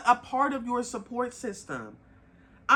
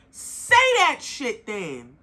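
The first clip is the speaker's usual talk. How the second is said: slow and firm, each word pressed; emphatic demand; 6 dB louder, much slower than usual. Real, not acted. Telling a tired partner to just say so, slow and firm. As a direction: spell it out slowly, no room to argue.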